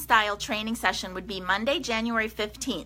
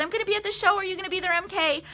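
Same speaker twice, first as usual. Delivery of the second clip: lightly voiced excited fan, higher; a viewer asking eagerly, pitched up; quoting eager viewers; 7 semitones higher, much faster than usual. Viewers asking if she'll be at the show, pitched up. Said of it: "you've already said".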